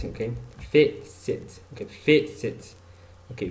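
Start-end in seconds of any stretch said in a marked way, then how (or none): 0.7-1.6 "facet" split in two, first syllable stronger; syllable-by-syllable "facet", weight on the first; first-syllable stress. Shown as a demonstration. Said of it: "louder sound". 1.8-2.7 "facet" in two separate beats, first one stronger; first-syllable stress. Shown as a demonstration. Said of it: "louder sound".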